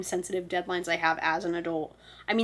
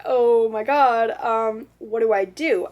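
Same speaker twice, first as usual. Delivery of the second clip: louder, drawn-out dismay; lightly hammed-up alarm; mock panic; 3 semitones higher, 7 dB louder, slower than usual. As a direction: act out a stuck, helpless realisation. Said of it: "And I was like".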